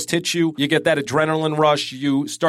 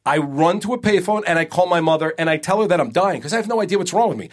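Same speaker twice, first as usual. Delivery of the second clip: normal talking voice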